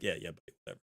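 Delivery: tired of hearing it again; weary brush-off. Pretend. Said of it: "he'd probably be like".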